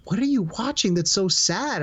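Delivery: family asking what's so sad; puzzled family. Retold or imitated. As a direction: ask like a worried relative. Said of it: "they're going".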